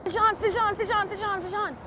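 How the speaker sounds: high repeated call, fading; calling out excitedly. Real, not acted. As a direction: sing out the alert. Calling out a bite.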